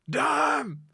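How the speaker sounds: high, loud heckler shouts; crowd yelling. Retold or imitated. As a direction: holler it up at the band like a rowdy fan. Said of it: "people would be like".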